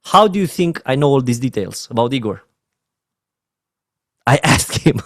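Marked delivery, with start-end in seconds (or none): 4.3-5.1 laughing. Real, not acted. Laughing at own question.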